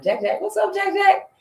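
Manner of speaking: playful family greeting pitched high; family calling out. Retold or imitated. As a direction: imitate relatives calling out to her. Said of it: "there on the tip of the tongue".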